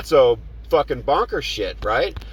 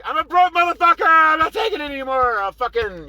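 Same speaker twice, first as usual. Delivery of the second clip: retold outburst. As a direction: blow up at him. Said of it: "I'm like".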